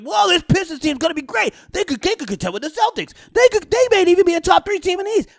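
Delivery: mock the bandwagon hype; hyped-up fan. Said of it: "Everyone's like".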